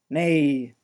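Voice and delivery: loud, insistent dwarf; a dwarf demanding his place, loudly; gruff dwarf voice. Acted. Dwarf objects.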